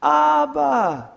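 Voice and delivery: put-on high, loud child's cry of Abba; a child's piercing cry for his dad; child screaming. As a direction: scream it like a little boy calling his father. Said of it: "what he screamed out".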